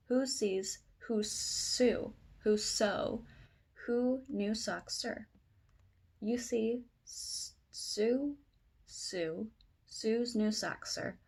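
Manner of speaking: slow, careful tongue twister; slow reading. Shown as a demonstration. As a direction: pick through the words one at a time. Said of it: "say this again faster".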